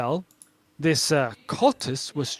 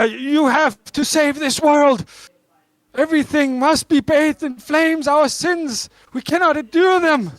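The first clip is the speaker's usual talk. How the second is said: cultist's high, loud, desperate rant; fanatical ranting; 11 semitones higher, 5 dB louder than usual. Acted. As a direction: cry it out like a doomsday preacher. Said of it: "is ranting on about".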